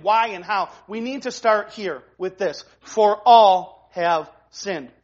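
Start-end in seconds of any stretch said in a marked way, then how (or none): 2.8-4.9 all drawn out slow and heavy; stress on all. Shown as a demonstration. Pastor stressing all to the congregation, slow and weighty. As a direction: lean hard on "all", slow it right down. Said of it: "The all is pretty, uh, ubiquitous".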